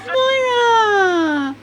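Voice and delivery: calling out. Acted. Calling the Sim character.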